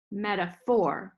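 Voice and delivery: "Metaphor" slowly, weight on "phor"; two light syllables, then land on the "phor" of "Metaphor"; stress on final syllable. Shown as a demonstration. Teacher demonstrating an anapest, final syllable of "Metaphor" stressed. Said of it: "is on the final syllable".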